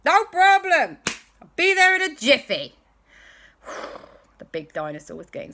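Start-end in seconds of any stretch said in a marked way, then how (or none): 0.0-2.8 loud, high, confident hero voice; can-do rescuer, pitched well up; Doctor Who. Acted. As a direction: call it out breezily at full volume. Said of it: "And she said".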